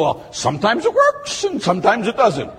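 a hint of a doubting churchgoer; mock shrugging doubter. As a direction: toss the line off like you half believe it. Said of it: "You go".

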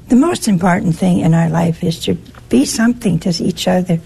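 normal talking voice

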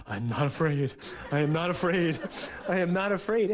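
put-on a scared boy under the covers talking himself into courage; frightened self-reassurance, building. A small boy alone in the dark, repeating it to himself, scared then firmer. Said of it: "shaking, going".

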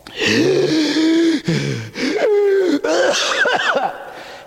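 long high shouted gasp; acted gasp of collapse. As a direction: gasp as if falling under the cross. Jesus falls again.

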